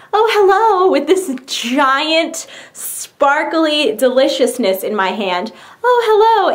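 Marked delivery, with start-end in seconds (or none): 0.1-0.9 high, put-on phone hello; mock-casual phone greeting. Answering the phone in front of relatives, mock-casual high hello. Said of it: "just casually be like". 5.8-6.5 high, put-on phone hello; mock-casual phone greeting. Answering the phone in front of relatives, mock-casual high hello. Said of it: "just casually be like".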